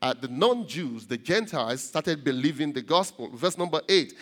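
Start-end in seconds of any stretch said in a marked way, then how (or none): none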